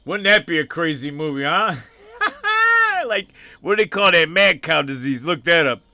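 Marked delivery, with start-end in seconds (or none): 2.1-3.0 a squealing burst of laughter, pitched way up; high laugh. Real, not acted. Laughing high at her own crazy movie idea.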